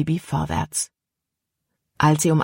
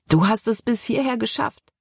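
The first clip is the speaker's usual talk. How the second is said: higher-pitched delight, rising to the end; thrilled for a friend who made it; enthusiastic; 6 semitones higher than usual. A narrator's reading. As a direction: voice lifted with enthusiasm. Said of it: "Begeisterung schwang in Calebs Stimme mit".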